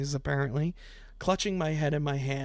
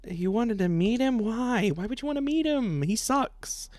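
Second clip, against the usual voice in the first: mock disbelief; 7 semitones higher than usual. Mocks the girl wanting to meet him.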